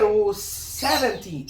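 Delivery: seventy said slowly without the t. Shown as a demonstration. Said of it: "sem o T". (seventy devagar, sem pronunciar o T)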